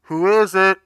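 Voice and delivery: high muffled voice behind a door. Acted. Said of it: "like a muffled voice saying".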